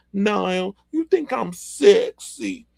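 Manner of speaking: high, slow teasing come-on. Retold or imitated. Voicing [name] teasing [name].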